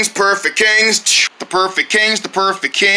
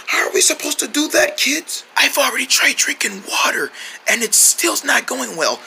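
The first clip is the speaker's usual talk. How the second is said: lost voice; 3 dB quieter than usual. Acted. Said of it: "A throat massage?".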